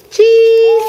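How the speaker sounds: loud, high, delighted cheese; excited. Real, not acted. Mom excitedly models word for baby.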